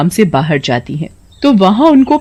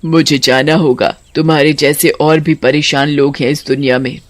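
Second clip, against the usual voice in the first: witch farewell; 9 semitones higher than usual. Acted. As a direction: say goodbye gently but firmly.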